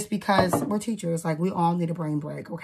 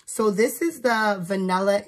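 ordinary delivery

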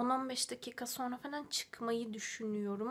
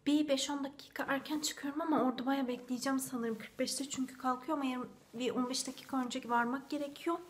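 ordinary delivery

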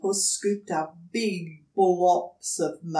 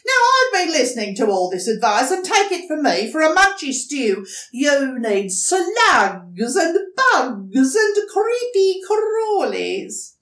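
a hint of a bossy echidna, higher and loud; know-it-all echidna; 8 semitones higher, 10 dB louder than usual. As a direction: lay down the law. Echidna lecturing.

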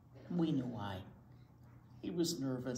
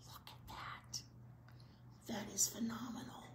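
soft amazed whisper; awed whisper; 5 semitones higher, 12 dB quieter than usual. Real, not acted. Teacher marveling at John's dance for the kids, awed whisper. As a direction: breathe it out in awe. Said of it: "whispered awe at picture".

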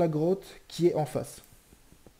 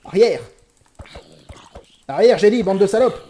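loud, higher battle yell; shouting back; 6 semitones higher, 13 dB louder than usual. Real, not acted. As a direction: drive them back with your voice. Fighting off zombies.

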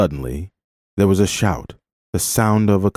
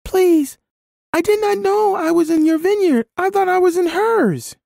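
put-on very high little child, pleading; child's voice. A narrator's reading. Child caught in a stranger's vineyard, pleading in a high voice. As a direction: plead like a frightened small child. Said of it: "a child's voice broke in".

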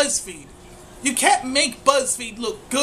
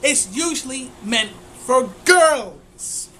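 ordinary talk, nothing marked

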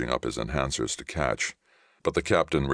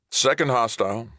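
high, raised alert; urgent callout; 9 semitones higher, 4 dB louder than usual. Acted. Navigator reports second hostile, urgent.

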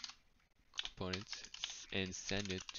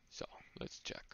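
whisper; 9 dB quieter than usual. Real, not acted. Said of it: "whispered to self while checking".

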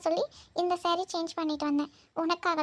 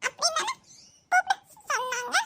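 put-on high stumbling little girl voice; child voice; 7 semitones higher than usual. Niece explaining dust in her eye, high childish. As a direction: stammer like a small child.

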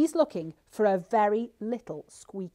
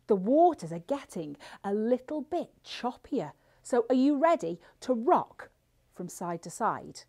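ordinary talk

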